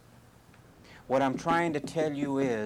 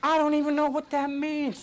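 exasperated; 12 semitones higher than usual. Retold or imitated. Exasperated retort in story.

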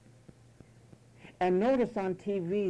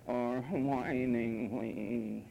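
very slow, drawn-out mock whine; lower, whiny moan for show; whining; 6 semitones lower, 4 dB quieter, much slower than usual. Shown as a demonstration. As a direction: stretch it into a pathetic bleat. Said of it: "bleat also means to speak foolishly".

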